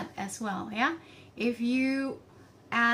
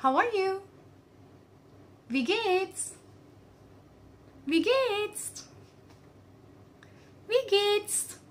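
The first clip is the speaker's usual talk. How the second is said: high cheerful greeting; 10 semitones higher, slower than usual. Shown as a demonstration. Teacher models greeting cheerily.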